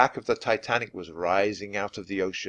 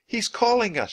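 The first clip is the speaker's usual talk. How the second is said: high, excited call; shouting; 13 semitones higher than usual. A narrator's reading. Annie hears the dog barking, shouting. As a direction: call it out, pitched way up. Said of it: "shouted Annie".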